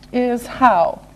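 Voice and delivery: ordinary delivery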